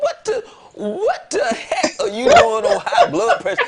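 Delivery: very high-pitched, incredulous mock yell; retold yelling. Man retelling how he scolded his ex over medication, yelling. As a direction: reenact yelling at her, voice shooting way up. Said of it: "I yelled at her".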